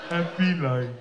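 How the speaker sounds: mock somber husband mantra; defeated married guy. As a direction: drone it like a man who gave up. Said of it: "those married guys who are like".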